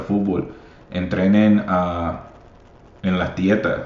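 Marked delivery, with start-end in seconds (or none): none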